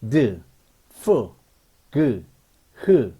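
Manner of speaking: "드, 프, 그, 흐" one by one with pauses; isolated consonant sounds. Shown as a demonstration. Teacher reads phonetic symbols to learners, isolated "드, 프, 그, 흐". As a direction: sound out each consonant separately: "드, 프, 그, 흐". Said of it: "이게 발음기호입니다".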